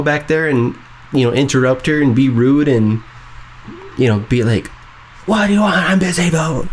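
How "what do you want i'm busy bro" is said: high, brusque, annoyed; mock annoyed snap. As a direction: brush him off rudely. Imitating her rude reaction.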